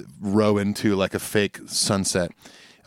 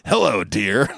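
a light, lazy greeting in a put-on Dave voice; a hint of the Dave impression, chuckling through it; Dave hello voice; slower than usual. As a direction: greet the wife as Dave would, half laughing. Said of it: "using the Dave impression".

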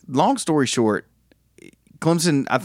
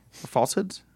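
ordinary talk

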